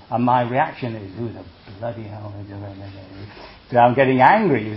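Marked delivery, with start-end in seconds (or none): 1.2-2.9 muttered mock outrage; mock indignation, softly. Monk voicing his own inner indignation, muttered. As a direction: grumble it under the breath.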